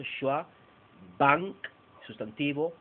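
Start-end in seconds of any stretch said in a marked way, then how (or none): 1.2-1.6 "bank" punched much louder; strong stressed noun. Shown as a demonstration. Teacher showing the strong stressed noun, much louder. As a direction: hit "bank" hard.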